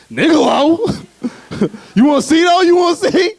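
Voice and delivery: high-pitched, hammed-up boasting; animated. Cocky limbo player offering to show them, in an animated high voice. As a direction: shoot the voice way up, eager to show off. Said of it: "Get real animated".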